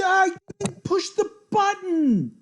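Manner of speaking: slow, high, loud wail of protest; screaming denial, drawn out slowly; anguished shriek. Real, not acted. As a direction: wail that it wasn't your fault. Accidental mouse-slip chess blunder.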